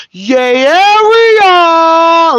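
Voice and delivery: "Yay, area" held very long, high and loud; drawn-out Yay Area call. Retold or imitated. Christ hyping up the Bay, high, loud and stretched. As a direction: sing out "Yay, area" like a hype shout-out. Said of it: "Like that".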